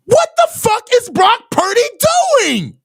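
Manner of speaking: high-pitched outraged yelling at the screen. Real, not acted. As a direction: yell at the TV in disbelief at the throw. Fan raging at interception on TV.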